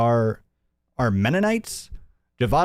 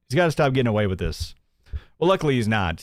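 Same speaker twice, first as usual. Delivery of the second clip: usual speaking voice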